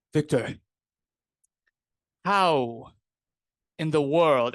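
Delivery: Reeves, hungover and sweating, loses his composure; lost his calm. Acted. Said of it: "has lost all of that, like, calm demeanor".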